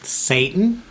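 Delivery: slow, high, prim church-lady voice; a hint of a sanctimonious church lady. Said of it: "The church lady?".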